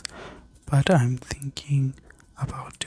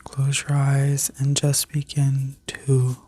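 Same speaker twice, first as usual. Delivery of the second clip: sleep story narrator. Acted. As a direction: guide them gently into rest. Guided visualization continues.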